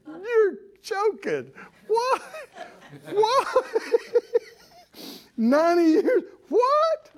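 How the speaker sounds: high-pitched laughing disbelief; incredulous Sarah. Retold or imitated. Sarah in the tent, hearing of the baby, high incredulous laughter. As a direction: squeak out a laughing what. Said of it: "Sarah goes".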